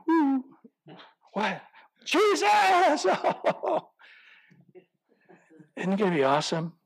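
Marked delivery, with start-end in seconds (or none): 0.1-3.0 a sudden, much higher and louder cry of joyful astonishment; delighted surprise. Pretend. Acted waking up beside Jesus. 3.1-3.8 gleeful laugh. Pretend. After acted waking cry.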